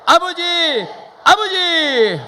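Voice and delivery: high, loud calling out to the Father; three full-voiced calls of 아버지; loud cry. Real, not acted. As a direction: cry out to God as loud as you can. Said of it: "다시 세 번 불러보겠습니다".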